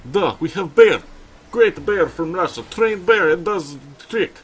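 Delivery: put-on Russian accent, over the top; mock Russian accent. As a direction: play a Russian circus bear announcer in a thick Russian accent. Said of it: "so I can give it a Russian accent".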